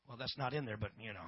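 low, soft muttered aside; quiet aside. Real, not acted. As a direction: throw it away under your breath.

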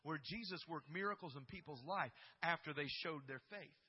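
loud, high, driving sermon voice; fired-up preacher building to the climax; preaching at full force. Acted. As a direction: preach it out to the whole church.

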